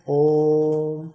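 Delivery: ओम held long, the vowel stretched; drawn-out Om. Shown as a demonstration. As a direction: draw out ओम like a chant. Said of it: "हमने लंबा खींचा है".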